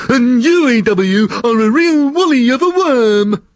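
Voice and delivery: Nifty the slug snapping back at E W in anger; angry retort. Acted. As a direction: shout back an insult at the worm. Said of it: "Nifty seemed to be just as angry".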